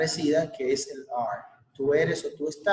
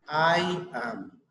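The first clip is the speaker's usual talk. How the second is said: model for repetition. Shown as a demonstration. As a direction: clear model phrase with a pause after it for the class to repeat. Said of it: "Listen and repeat". (modelo claro para repetir)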